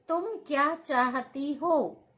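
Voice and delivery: ordinary talk, nothing marked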